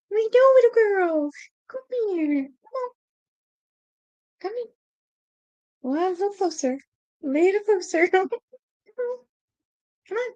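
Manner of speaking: sing-song pet voice, coaxing; high coo calling a cat closer. Real, not acted.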